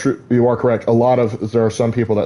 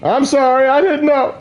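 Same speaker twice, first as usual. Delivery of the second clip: high-pitched, startled mock apology; flustered apology. Intruder caught by a plantation guard, playfully flustered apology. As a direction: squeak out a hammed-up sorry.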